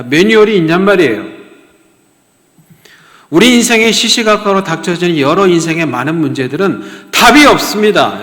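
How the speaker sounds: loud, high, pressing sermon voice; fiery preaching. Acted. Preacher driving it home to the congregation, loud and urgent. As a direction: hammer the point home to the congregation.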